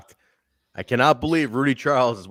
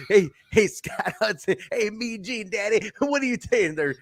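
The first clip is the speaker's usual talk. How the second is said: high-pitched wrestler heel shtick; Johnny Swinger impression; 8 semitones higher than usual. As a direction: do a comic high-voiced heel calling out names. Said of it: "I'm hearing it in today's Swinger voice".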